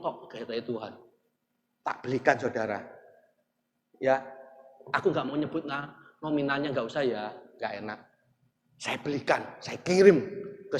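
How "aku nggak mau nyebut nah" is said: usual speaking voice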